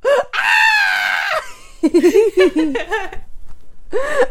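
high screaming laugh of joy; delighted shriek laugh. Real, not acted. Overjoyed at episode topic.

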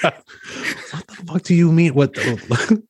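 half-laughing cowboy put-on; mock drawling cowboy. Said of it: "What is this voice you're doing".

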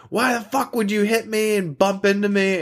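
high squeaky mock voice of the screaming woman; shrill imitation of a furious customer; imitating yelling woman. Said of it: "she's like".

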